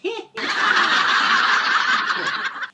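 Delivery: loud, high evil cackle; evil laugh. Acted. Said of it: "getting evil with her laughing".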